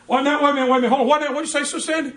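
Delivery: high, loud, mock-stern halt. Pastor stopping the gossiping sister, loud and high. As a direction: stop her cold, playing it big.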